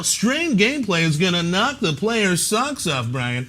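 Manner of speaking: booming announcer hype; newscaster voice. Acted. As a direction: sell it like a TV newsreader. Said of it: "that voice was awful".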